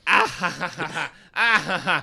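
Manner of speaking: maniacal villain laugh; imitated mad villain's cackle; Willem Dafoe. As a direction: cackle in separated, staccato bursts. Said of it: "Doing his Willem maniacal laugh".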